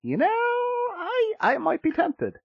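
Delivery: high, drawn-out musing; mock-reluctant, teetering on giving in; playfully tempted. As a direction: stretch the words out slowly, way up high.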